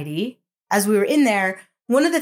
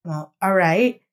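ordinary delivery

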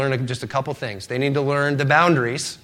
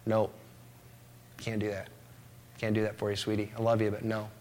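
gentle refusal; 5 semitones lower, 12 dB quieter than usual. Acted. Dad gently refusing his daughter.

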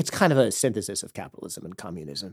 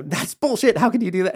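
higher, louder indignant outburst; in-your-face confrontation; 9 semitones higher, 5 dB louder than usual. Retold or imitated. Speaker retelling a challenge to hateful people, indignant. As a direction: get right up in their face and challenge them. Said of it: "I just get up in people's faces".

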